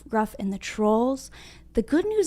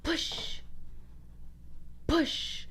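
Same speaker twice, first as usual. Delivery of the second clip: whisper; 4 semitones higher, 13 dB quieter, much slower than usual. Real, not acted. Whispered cue to the children.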